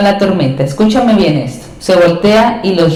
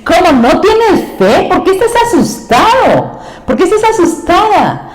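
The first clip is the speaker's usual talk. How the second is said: much higher, insistent scolding questions; Jesus reprimanding; 9 semitones higher than usual. Acted. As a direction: press each question like a disappointed master. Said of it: "Los está reprendiendo". (Jesús regañando, voz muy aguda e insistente)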